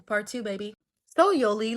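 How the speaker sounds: plain reading voice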